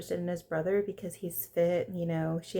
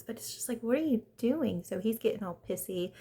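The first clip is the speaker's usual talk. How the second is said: usual speaking voice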